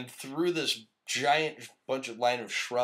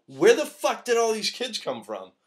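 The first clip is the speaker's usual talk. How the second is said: shocked yell; 10 semitones higher, 7 dB louder than usual. Retold or imitated. Retold fight, shocked aside.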